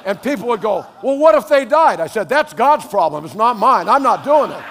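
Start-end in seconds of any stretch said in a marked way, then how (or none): 1.0-2.0 mock shout. People's objection mimicked. 2.3-4.6 laughing retort. Retold or imitated. His retold reply, laughing.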